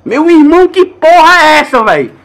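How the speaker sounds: high, loud and slowed-down exclamation; real astonishment at something eerie; stunned disbelief. Real, not acted. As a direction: let the shock stretch every word. Sees strange fire moving.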